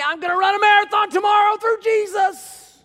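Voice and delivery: high, loud, hammed-up boast; mock braggart shout. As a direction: shout it like a cocky misquoter mocking the verse. Mocking a misquote of the verse.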